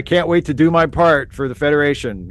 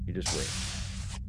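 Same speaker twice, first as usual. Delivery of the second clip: slow, breathy whispered promise; a slow hushed whisper of ambition; 6 semitones lower, 14 dB quieter, much slower than usual. Shown as a demonstration. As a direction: whisper it like a secret threat.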